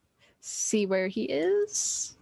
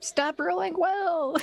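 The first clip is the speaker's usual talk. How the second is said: high, drawn-out complaint; mock protest; 8 semitones higher, much slower than usual. Real, not acted. Player moans at game master's good dice, mock protest. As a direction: wail it slowly, playfully exasperated.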